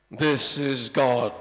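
low, loud, slow divine voice; booming voice of God. Acted. God answering the man on the branch, deep and booming. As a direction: boom it down from heaven. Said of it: "God always has to talk in that voice".

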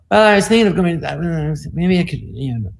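halting mumble that trails off; mumbled thinking aloud. Retold or imitated. College kid asked about today's plans, mock halting mumble. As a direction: imitate your younger self fumbling for words. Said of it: "I would talk like that".

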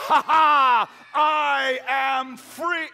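loud high triumphant roar; triumphant shout. Acted. Freed lion shouts his triumph, loud and high. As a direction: bellow your freedom.

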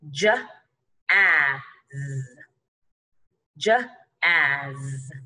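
"j, a, z" as separate letter sounds with pauses; sounded out. Shown as a demonstration. Teacher shows how jazz goes, sound by sound. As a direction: sound out j, short a, one z. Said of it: "this is going to go".

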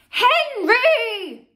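high, loud scolding scream. A narrator's reading. Mum screams at Henry for pouncing on Peter, high and loud. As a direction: scream his name to stop him. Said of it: "screamed Mum".

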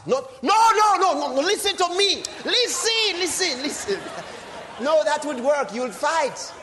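a full-throated plea from the pulpit; shouting preacher. Acted. Preacher shouting at believers to stop and listen, loud cry.

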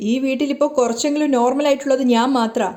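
ordinary delivery, nothing marked

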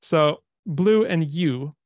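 "blue" und "you" langsam und betont; slow stress on rhyme. Shown as a demonstration. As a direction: draw out blue and you slowly. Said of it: "what's being repeated here?".

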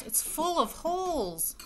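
high, slow dismay; dismayed wail. Acted. Wife wailing that her dress is ruined, high and slow. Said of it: "exclaimed Missus Rogers".

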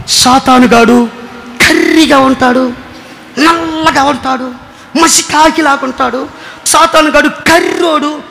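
slow, drawn-out imitation of the old pastor; old pastor's words. Retelling the old pastor's sermon line, slow mock emphasis. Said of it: "ఏమనేవాడు తెలుసా?".

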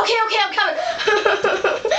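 high-pitched, flustered reply; flustered hurrying. Acted. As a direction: squeal that you are coming after being yelled at. Startled contestant hurries out after yell.

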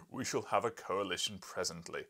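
loud booming line; boomed. Acted. Professor to wife and guest, a loud boom. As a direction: boom it across the hall. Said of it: "he boomed".

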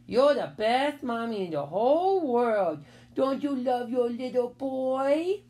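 put-on slow, babyish sweet talk; butter up Mommy like a small child; drawn-out childish pleading, rising at the end; wheedling little boy. A narrator's reading. Little boy wheedles his mother.

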